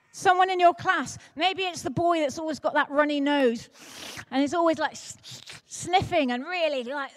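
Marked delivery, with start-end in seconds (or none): 4.9-6.3 sniffing sound. Retold or imitated. Imitating runny-nosed boy.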